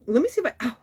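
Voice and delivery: strained, breaking off in pain; wincing in pain. Real, not acted. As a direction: try to move and get cut off by pain. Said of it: "wincing and yammering".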